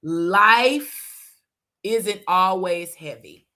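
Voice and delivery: slow, each word set apart; said slowly. Shown as a demonstration. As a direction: let every word land slowly. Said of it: "let me say this slowly".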